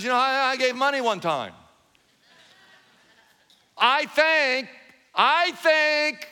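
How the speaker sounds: lightly voiced proud churchgoer; mock self-assured churchgoer. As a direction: parody the know-it-all believer.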